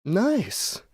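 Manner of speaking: high, put-on approval; drawn-up, easily impressed reaction; mock pleased user. User approving the AI's crude beat, mock-impressed. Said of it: "It's like".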